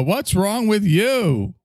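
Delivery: high, very slow, sweetly baffled; hammed-up sugary disbelief; mock cheery host. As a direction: stretch it out in disbelief.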